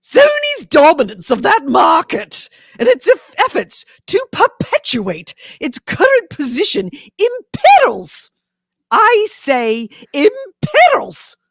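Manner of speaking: put-on grand old Hollywood transatlantic accent, drawn out. Shown as a demonstration. Co-host requests it while he reads the senator's letter, hammy transatlantic accent. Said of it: "do it in the transatlantic voice".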